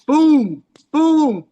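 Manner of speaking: punchy booms, loud and high; mock punch sounds. Telling friends about the fight, mimicking loud punch booms. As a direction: smack out each boom like a blow landing.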